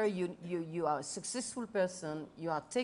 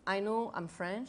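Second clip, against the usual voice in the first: normal talking voice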